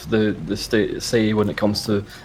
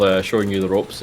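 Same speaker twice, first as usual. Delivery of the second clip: ordinary talk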